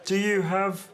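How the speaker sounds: lightly hammed stilted read; mock stilted repeat. Shown as a demonstration. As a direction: mimic the wooden question. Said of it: "There you go".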